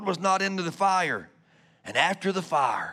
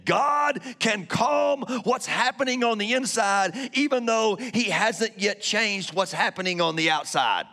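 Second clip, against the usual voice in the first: shouted repeat; 3 semitones higher than usual. Shown as a demonstration. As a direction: preach it out with force the second time. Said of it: "I'm gonna say that again".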